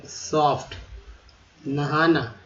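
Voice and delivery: normal talking voice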